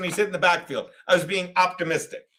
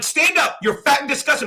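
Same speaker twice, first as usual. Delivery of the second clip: high, re-enacted yelled order; retold yelling; 6 semitones higher than usual. Host retelling how he confronted the man, mock yelling. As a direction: act out ordering someone up, voice pitched high. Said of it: "'cause I'm yelling".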